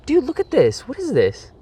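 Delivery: high, shocked and grossed out; disgusted surprise. Real, not acted. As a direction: recoil at something gross. Finds green goo on his hands.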